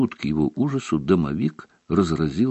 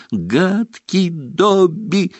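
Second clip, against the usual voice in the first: high, slow elf yelling at himself; self-scolding elf cry; 12 semitones higher, much slower than usual. Acted. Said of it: "кричал он при этом".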